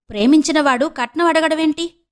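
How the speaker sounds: mock the idea of a loving groom asking dowry; sarcastic. A narrator's reading. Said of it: "వ్యంగ్యంగా అంది రేణుక".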